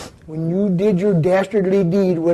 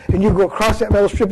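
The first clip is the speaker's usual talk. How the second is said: ordinary talk, nothing marked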